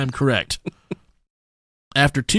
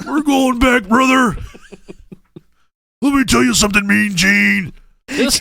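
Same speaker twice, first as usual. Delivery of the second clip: loud, higher pumped-up promo voice; wrestler promo; 4 semitones higher, 3 dB louder than usual. Retold or imitated. A wrestler promising Mean Gene to go back, pumped-up shout. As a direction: hype it like a pro wrestler cutting a promo.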